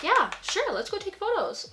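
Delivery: chirpy quote of the fiancé, high up; eager fiancé. Retold or imitated. Fiancé quoted agreeing to photos.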